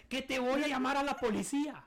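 high-pitched mock threat; indignant caller threat. Caller threatens the call-center agent, indignant. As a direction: imitate an outraged customer threatening.

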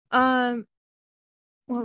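talking mid-sip; while sipping a drink. Real, not acted. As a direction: sound of a sip before words. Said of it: "I'm sorry, I'm drinking wine".

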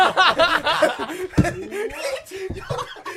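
loud high burst of laughter. Real, not acted. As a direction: crack up laughing hard. Laughs at own Craigslist joke.

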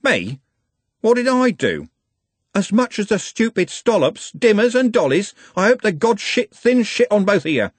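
high, indignant cursing; furious tirade. A narrator's reading. Trev cursing the gang who killed his friend, furious. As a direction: curse them all with venom.

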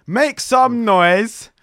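slow, stretched, loud hype call; hype announcement. Acted. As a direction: call the guest in like an MC. Host hyping the guest in.

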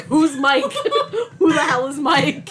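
loud high laughing questions; indignant through laughter; laughing outrage. Real, not acted.

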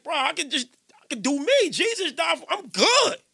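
put-on his old careless self, voice climbing high; mocking his younger self. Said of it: "I used to be like".

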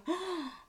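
sharp high imitated gasp of surprise; mock shocked gasp. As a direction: gasp like you've been wrongly accused. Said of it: "And Erica's just like".

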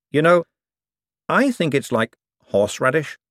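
thoughtful. A narrator's reading. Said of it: "said a doorbell thoughtfully".

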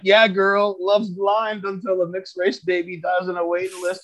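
high-pitched, sing-out fake encouragement; mock cheering on. Acting out his old mocking heckles.

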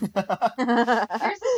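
laughing. Real, not acted. Laughing reply.

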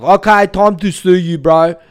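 loud, high put-on voice; Harvey Yemeni. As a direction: announce it in a brash mock shout. Same impression of Harvey resumed.

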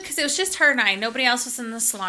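ordinary delivery

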